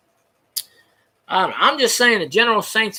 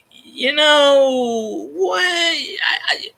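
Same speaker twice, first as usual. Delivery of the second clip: mock sobbing; 8 semitones higher, much slower than usual. Mock sobbing quote of Cam.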